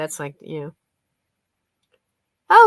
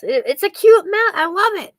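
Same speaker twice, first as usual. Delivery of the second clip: gushing; 10 semitones higher, 8 dB louder than usual. Real, not acted. Gushing over cute mouse.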